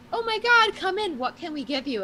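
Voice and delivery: high, excited welcoming voice; eager restaurant staff. Retold or imitated. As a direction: gush like a delighted host.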